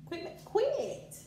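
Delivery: sharp high scolding quit; scolding bunny. Real, not acted. Scolding her bunny to stop scratching, sharp high rebuke.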